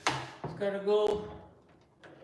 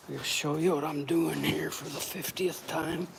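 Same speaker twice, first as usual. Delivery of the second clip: ordinary talk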